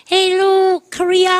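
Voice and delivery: high, drawn-out concert greeting. Retold or imitated. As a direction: greet the arena in a high star voice. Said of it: "[name]가".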